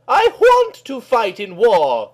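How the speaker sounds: put-on a determined young girl insisting; Kelly's line. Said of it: "she said".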